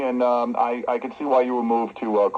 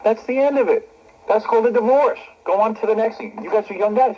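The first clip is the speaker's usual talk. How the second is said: high-pitched, worked-up insistence; emphatic insistence; 9 semitones higher than usual. Real, not acted. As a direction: drive the point home, high and emphatic. Host insisting divorce settles it.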